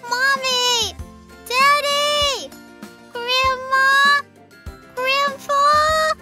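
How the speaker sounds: high, drawn-out calls; calling out for family. Acted. Lost baby shark searching for family, calling out.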